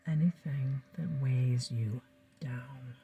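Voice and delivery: slow, soft whisper. Acted. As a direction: let it drift out, unhurried. Hypnotist guiding breathing.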